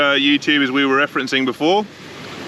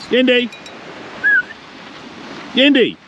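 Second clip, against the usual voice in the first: calling out; 13 semitones higher than usual. Real, not acted. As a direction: yell her name across the beach. Calling the dog running off.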